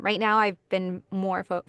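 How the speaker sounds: usual speaking voice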